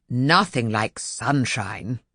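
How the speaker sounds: slow, self-pleased voice of the sun; sun flattering himself. A narrator's reading. The sun admiring his own shine, slow and pleased. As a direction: savour it slowly, pleased with yourself. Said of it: "he flattered himself".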